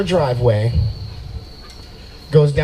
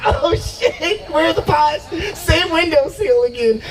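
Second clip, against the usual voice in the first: high-pitched giddy eagerness; mock aroused excitement; 12 semitones higher, slower than usual. Acts himself turned on by belle.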